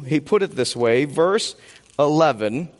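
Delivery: normal talking voice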